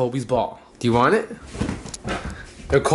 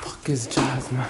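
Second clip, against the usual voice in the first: whispering; 5 semitones lower, 6 dB quieter, slower than usual. Real, not acted. Whispering while searching for dog.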